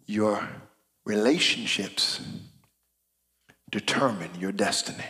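a soft, weighty closing thought; hushed emphasis. Real, not acted. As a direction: let the key line land quietly. Sermon drops to intimate close.

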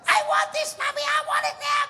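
put-on very high bratty child voice; high-pitched tantrum brat; whiny screaming kid. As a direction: whine like a spoiled toddler. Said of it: "kicking and screaming at his mother".

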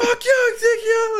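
high, loud, wailing curses; mock angry yelling. Friend acts out the viral video guy, loud high angry yell. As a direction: rant like a furious man on a roof.

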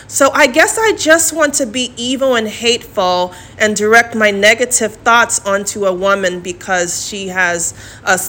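ordinary talk

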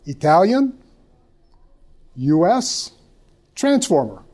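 lightly voiced halting questioner, rising tune; a hint of a hesitant, questioning stranger; woman in the store. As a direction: imitate a woman asking word by word, with long gaps. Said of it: "this woman walks up, she goes".

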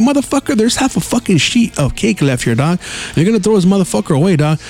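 high, fast retold argument; mock heated rant. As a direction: rant back at him, pitch climbing high. His own retold rant.

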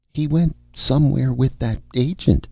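high, uncertain star voice; vague, dim film star. Acted.

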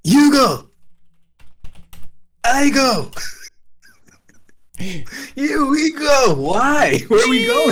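high, loud shouted hero call; mocking transformation cry. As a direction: yell it like a silly henshin. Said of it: "You sound horrible".

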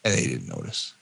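low, quiet letdown; deflated. Real, not acted. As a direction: let all the air out. Dropped refrain after proud build-up.

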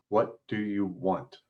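the bored guard answering slowly and quietly. Acted. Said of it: "he says just as slowly".

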